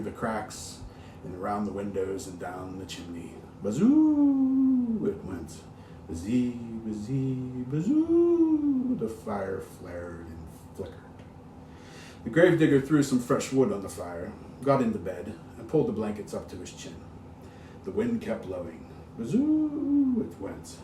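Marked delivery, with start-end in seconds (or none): 3.6-5.1 howling wind. A narrator's reading. Wind sound in ghost story. 6.1-9.0 wind rising to a howl. A narrator's reading. Narrator for children, wind that rises to a high howl. Wind sound in ghost story. 19.2-20.2 high swooping wind howl. A narrator's reading. Narrator for children, a high wind howl. Wind sound in ghost story.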